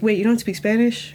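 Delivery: usual speaking voice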